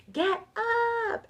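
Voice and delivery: very high, drawn-out mock scream; retold yell. Woman yelling so loud at herself to get up, retold yell. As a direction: stretch it out high, imitating a desperate yell. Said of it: "yelling so loud".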